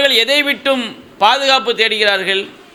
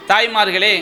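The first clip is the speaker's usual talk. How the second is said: usual speaking voice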